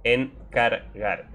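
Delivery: "Encargar" drawn out very slowly; pun spelled out. Shown as a demonstration. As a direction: stretch "Encargar" to land the pun. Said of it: "¿Lo pillas?". ("Encargar" muy lento, remarcando el chiste)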